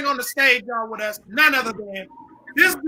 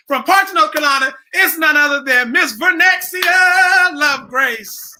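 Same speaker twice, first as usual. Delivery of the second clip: loud, slow, drawn-out host intro; announcer introduction; 3 semitones higher, 4 dB louder, slower than usual. Acted. Host introducing a guest to the live audience, big announcer shout. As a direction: call the name out like a stage emcee.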